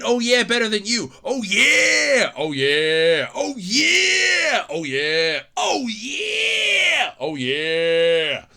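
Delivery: two rivals trading Oh yeah; hammed-up shouting match; dueling catchphrases. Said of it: "I can just see that now".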